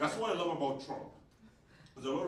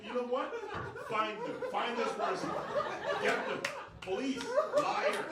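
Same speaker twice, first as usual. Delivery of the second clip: mock alarm. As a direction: call out the liar like summoning police. Mock calling police on audience member.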